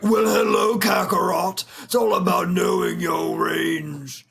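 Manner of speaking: high, shouted, arrogant sneer; Vegeta voice. Retold or imitated. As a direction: greet a rival with contempt.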